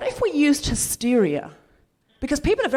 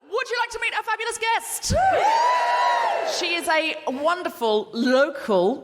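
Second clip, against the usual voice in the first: high, hyped-up guest introduction; excited live MC intro; stage announcer; 7 semitones higher, slower than usual. Real, not acted. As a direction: shout to rouse the crowd, stretching the build-up.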